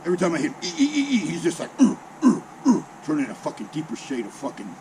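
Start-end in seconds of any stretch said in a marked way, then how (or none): 0.6-1.3 short, high squeaks in a row; high squeaky step noise. Retold or imitated. Recruit splashing the sergeant with every step, high squeaks. 1.8-2.8 high, loud grunts, spaced apart; seething grunts. Retold or imitated. Sergeant getting splashed without flinching, angry imitated grunts.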